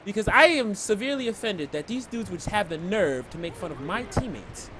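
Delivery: normal talking voice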